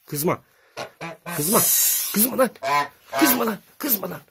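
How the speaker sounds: high, loud, frightened pleading; high scared yelps. Real, not acted. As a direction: squeal at the goose to calm down. Goose attacking him. (kaza korkuyla ince çığlıklarla yalvarma)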